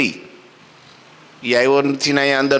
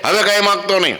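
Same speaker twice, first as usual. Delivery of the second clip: stern housewife; 7 semitones higher, faster than usual. Acted. Housewife warns the monk again, higher and sharp. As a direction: snap a warning, higher. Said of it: "ખાઈ લે કે".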